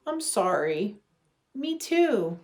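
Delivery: normal talking voice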